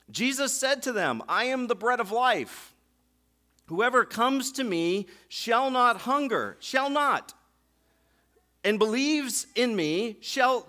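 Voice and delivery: loud, emphatic sermon reading; forceful preaching. Acted. Pastor reading John six to church, preaching loudly. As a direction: preach the verse with weight.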